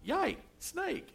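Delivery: put-on high childish squeal; little niece squeal. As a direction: squeak it out like a startled little girl. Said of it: "my little niece goes".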